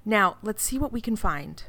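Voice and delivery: ordinary delivery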